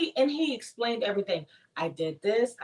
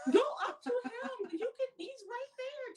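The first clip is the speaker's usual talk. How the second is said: high urging; 13 semitones higher, 6 dB quieter than usual. Real, not acted. Urging from the sidelines.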